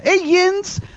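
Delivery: high, mock Philly accent; squeaky-high Philadelphia accent parody. Shown as a demonstration. Self-styled sociologist demonstrating a high mock Philly accent. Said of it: "I've studied the Maryland accent in the Philadelphia".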